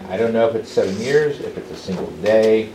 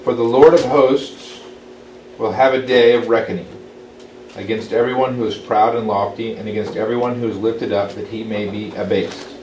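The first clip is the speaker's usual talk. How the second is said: raised, louder solemn reading voice; scripture reading; 4 dB louder than usual. Acted. Teacher reading Isaiah aloud to the Bible study, solemn. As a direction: read it out as holy text.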